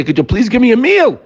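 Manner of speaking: high, whiny plea for a meal; pleading servant. Acted. Hungry servant begging his friend for a meal, whiny.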